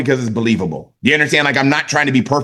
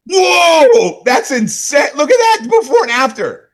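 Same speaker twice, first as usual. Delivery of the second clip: much higher, loud astonished whoa; wildly amazed; 11 semitones higher, 4 dB louder, slower than usual. Shown as a demonstration. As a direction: gush over the pictures as if seeing them first. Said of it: "like you've never seen it before".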